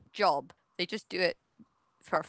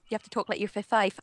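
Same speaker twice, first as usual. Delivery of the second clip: usual speaking voice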